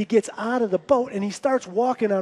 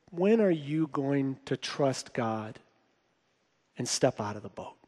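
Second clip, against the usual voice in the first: quiet, grave challenge; 7 semitones lower, 8 dB quieter than usual. Retold or imitated. Friend challenging the preacher at lunch, quiet and grave. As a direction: let it sink lower and softer toward the end. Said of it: "he kinda pauses".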